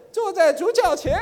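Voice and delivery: lightly hammed-up odd intonation; mock odd tune. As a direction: imitate the weird tone, then give up. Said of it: "我不会学啦".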